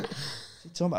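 unvoiced whisper of confusion; audience whisper. Audience members whispering to each other, baffled. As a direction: hush it like a puzzled crowd. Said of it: "everyone's going".

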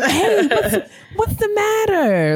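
put-on high, gentle coaxing to a crying kid; sweet soothing voice. Aunt soothing a crying child in the elevator, high and gentle.

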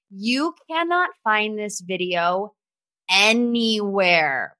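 "cannot" lifted and "anywhere" stretched out slowly; drawn-out emphasis. Real, not acted. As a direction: lean on "cannot", then let "anywhere" hang. Stressing the video's absence.

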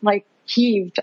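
ordinary talk

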